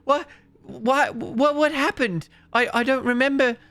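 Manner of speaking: put-on high, panicked child's voice, stammering; a scared little boy firing questions; bewildered, frantic child. The boy asks his father why he was underground, high and panicked. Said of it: "the boy is bewildered and frantic".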